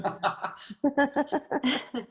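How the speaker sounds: bursting into a high laugh. Real, not acted. Laughing at the hand gesture.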